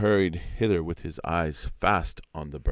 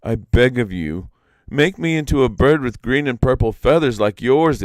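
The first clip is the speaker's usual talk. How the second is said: higher, louder begging; imploring Iktomi; 6 semitones higher, 8 dB louder than usual. Acted. Iktomi begs the peacock to change him, loud pleading. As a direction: plead with the bird.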